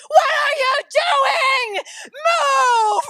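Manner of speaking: high, very loud panicked scream; re-enacted scream. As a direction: scream it at the top of your voice, mama bear charging. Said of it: "and I'm screaming".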